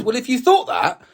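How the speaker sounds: mock-frustrated retort to a friend; retold exasperated question. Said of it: "And I said".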